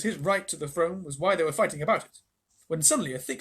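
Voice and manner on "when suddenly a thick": plain reading voice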